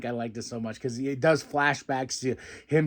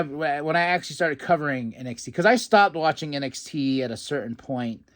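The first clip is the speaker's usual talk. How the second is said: ordinary talk, nothing marked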